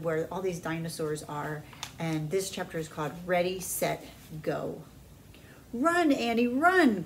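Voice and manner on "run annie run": urgent high cry; cried out. A narrator's reading. Jack crying out to Annie to flee, urgent. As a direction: yell it to get her running. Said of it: "cried Jack".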